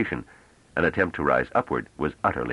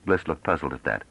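normal talking voice

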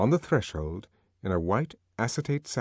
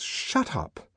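1 exasperated; 6 semitones higher than usual. A narrator's reading. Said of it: "breaking her stubborn silence".

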